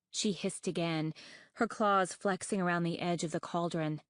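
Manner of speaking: plain reading voice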